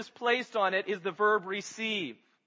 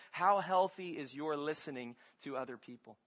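usual speaking voice

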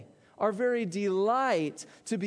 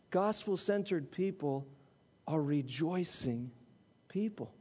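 hushed emphasis; 8 semitones lower, 7 dB quieter than usual. Real, not acted. Sermon punchline dropped low.

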